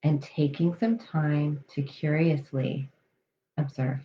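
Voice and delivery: calm, measured meditation voice with long pauses; guided meditation. Real, not acted. Coach leading listeners through breath awareness, guided meditation. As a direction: settle them into the breath, unhurried and even.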